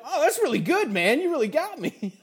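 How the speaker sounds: high, loud impression of an impressed brother; retold brother. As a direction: imitate the gullible brother, breaking into laughter at the end. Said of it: "He's like".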